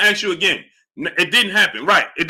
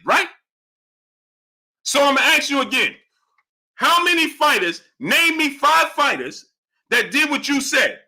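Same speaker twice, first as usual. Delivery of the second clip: higher, rising into an exasperated near-shout; heated challenge; 6 semitones higher than usual. Real, not acted. Host confronting a chat commenter over his boxing claims, exasperated and raised. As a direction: press the commenter hard, voice climbing.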